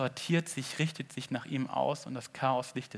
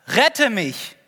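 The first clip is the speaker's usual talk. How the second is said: loud desperate plea, higher pitch; crying out; 7 semitones higher, 11 dB louder than usual. Acted. Peter shouting to Jesus in the storm, desperate cry. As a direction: yell rette mich like you're going under. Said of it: "schrie er".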